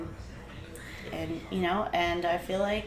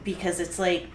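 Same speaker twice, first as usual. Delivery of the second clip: usual speaking voice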